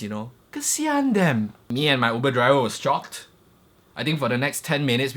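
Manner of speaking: ordinary delivery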